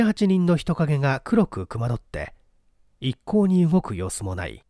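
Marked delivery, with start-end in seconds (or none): none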